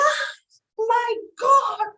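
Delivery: high shocked cry of fright; mock yelp of surprise, pitched high; startled scream. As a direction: shriek it as the cow's face appears. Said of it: "And I screamed".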